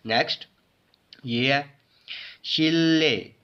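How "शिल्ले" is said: शिल्ले with a held double L; blended L sound. Shown as a demonstration. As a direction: join शिल्ले on a long ल. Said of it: "it is". (शिल्ले, लंबे लाम की आवाज़ से)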